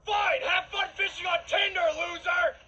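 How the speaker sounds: high, very loud taunt; angry parting shout. Acted. Girlfriend storming off from her boyfriend, furious high shout. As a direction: yell it as a scornful parting shot.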